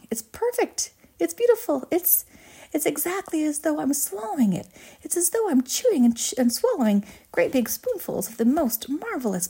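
high-pitched, gushing wonder; rapturous delight. A narrator's reading. Violet raves about her gum meal, high excited delight. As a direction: gush as each taste arrives.

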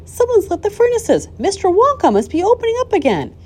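very high, loud townsfolk voice; excited cry. A narrator's reading. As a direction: spread the astonishing news at a cry. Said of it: "they cried".

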